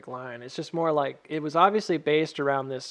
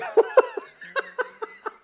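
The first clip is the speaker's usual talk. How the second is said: high burst of laughter; 5 dB louder than usual. Real, not acted. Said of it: "The wrong song".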